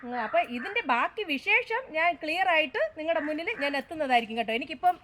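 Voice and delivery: ordinary delivery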